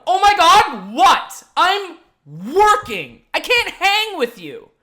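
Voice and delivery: very loud, high-pitched blow-up; exasperated shout. Retold or imitated. Host on the clock yells at drunk friend, loud and exasperated. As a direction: yell it in broken bursts, fed up at being interrupted. Retold outburst at the interrupting friend.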